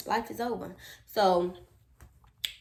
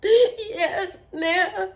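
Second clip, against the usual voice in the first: high whimpering answer; mock-tearful compliance; 13 semitones higher, 9 dB louder than usual. Child answers the adults, high and tearful. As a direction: squeak it as if crying.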